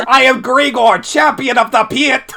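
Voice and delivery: high, very loud roaring boast; full-force in-character scream; screamed battle cry. Acted. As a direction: bellow it like a pit champion announcing himself. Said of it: "And he screams".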